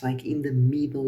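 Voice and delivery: ordinary talk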